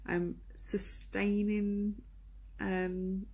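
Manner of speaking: ordinary delivery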